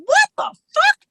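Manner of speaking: high, loud disbelieving cry; shocked outburst. Retold or imitated. Wife hearing the therapist's verdict, a high shocked outburst. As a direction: squeal it in outrage. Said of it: "I was like".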